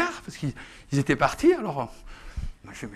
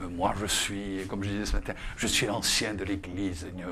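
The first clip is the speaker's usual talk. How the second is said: mock pompous elder; 7 semitones lower, 7 dB quieter than usual. Mocks a pompous church elder.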